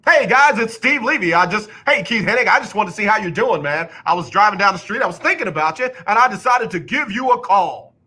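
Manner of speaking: hammed-up announcer on a phone call; mock Steve Levy. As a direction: imitate a sportscaster making a casual call. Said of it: "Come on".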